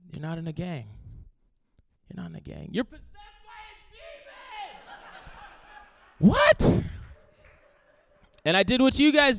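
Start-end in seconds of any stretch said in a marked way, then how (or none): 0.1-2.7 very soft, halting pronouncement, imitated; grave verdict. Parents' grave quiet verdict, retold. 2.7-6.3 sudden very high, strained mock cry; horrified accusation. Parents' accusation, retold. 6.3-6.8 very high, loud mock yelp; shocked yelp. Retold shocked reply.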